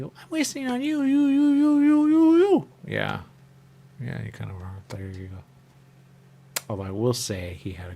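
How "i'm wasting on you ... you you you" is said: very high, rising and louder to a shriek; hammed-up frustrated yell; mock scream. At the person in line, a rising hammed-up shriek.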